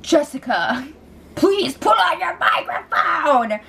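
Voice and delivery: slow, put-on announcement voice; Big Brother. Voice of the house orders Jessica, mock announcement. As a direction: call it out slowly like a house announcer, voice rising high. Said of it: "And then Big Brother's like".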